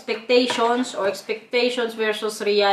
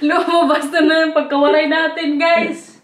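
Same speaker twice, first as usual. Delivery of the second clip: higher voice breaking into laughter; laughing; 4 semitones higher than usual. Real, not acted. Vlogger caught out on her Waray accent, laughing hard. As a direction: crack up while admitting it. Cracking up over own accent.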